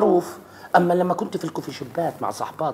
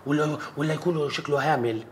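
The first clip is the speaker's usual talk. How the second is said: ordinary talk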